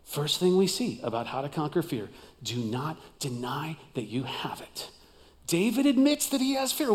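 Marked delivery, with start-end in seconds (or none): 2.4-4.9 hushed whisper of the point. Real, not acted. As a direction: lean in and whisper it.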